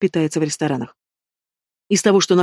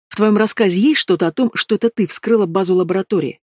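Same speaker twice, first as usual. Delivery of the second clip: ordinary delivery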